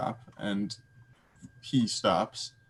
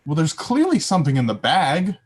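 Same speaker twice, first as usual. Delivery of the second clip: higher, louder self-justification; defensive retort; 6 semitones higher, 7 dB louder than usual. Acted. Adventurer answering companion about poking the bag, defensive. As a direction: push back, insisting it's obvious.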